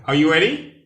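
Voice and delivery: Are you ready called out loud and high; loud rallying question. Real, not acted. Teacher calling out to rally the class loudly. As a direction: rouse the class.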